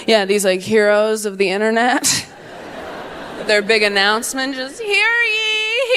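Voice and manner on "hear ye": much higher, proclaiming herald cry; mock herald. Influencer's big announcement, mock town crier.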